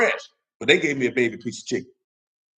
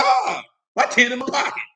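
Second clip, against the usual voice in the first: excited; 9 semitones higher than usual. Retold or imitated. Finding his ten in pocket.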